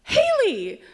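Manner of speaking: high, surprised calling of a name; a high mock call across the crowd. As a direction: Carol spotting her friend and calling her name. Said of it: "she goes".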